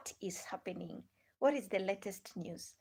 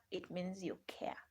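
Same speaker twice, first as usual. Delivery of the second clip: soft unvoiced whisper; whispered aside; 10 dB quieter than usual. Real, not acted. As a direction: breathe it as a secret aside. Aside to viewers on sharing.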